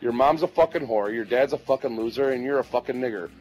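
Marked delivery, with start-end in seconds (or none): none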